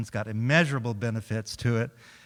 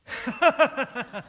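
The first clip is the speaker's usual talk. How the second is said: cue laugh; 12 semitones higher than usual. Shown as a demonstration. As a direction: burst into a big laugh on cue. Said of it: "on cue, I'm just gonna, come on".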